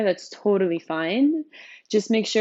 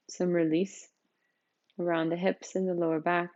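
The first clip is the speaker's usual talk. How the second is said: softer, unhurried voice, settling listeners into the body; guided meditation; 4 dB quieter than usual. Real, not acted. Teacher leading newcomers through a seated practice, calm meditation guidance.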